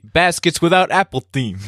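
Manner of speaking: slow, high mock Brooklyn mover. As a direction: read the box label as a dim mover. Improvised Brooklyn mover explains box labels.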